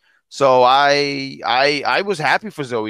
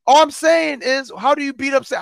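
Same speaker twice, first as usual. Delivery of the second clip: heated high protest; 11 semitones higher than usual. Real, not acted. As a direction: insist in a raised voice. Heated defence in argument.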